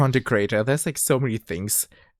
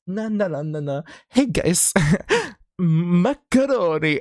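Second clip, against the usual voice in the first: sing-song streamer voice; 8 semitones higher, 3 dB louder than usual. Retold or imitated. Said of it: "I'm going like".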